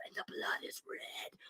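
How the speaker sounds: hushed, eerie stage whisper; ominous whisper. Acted. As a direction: whisper it like a horror reveal. Ominous moment in the recap.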